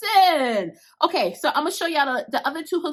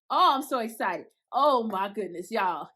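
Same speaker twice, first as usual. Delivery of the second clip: ordinary delivery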